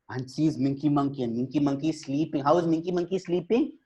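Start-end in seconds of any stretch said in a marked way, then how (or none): none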